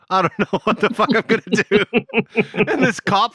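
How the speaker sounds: fast, high, laughing speech; laughing through it. Real, not acted. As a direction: talk while cracking up. Laughing while telling.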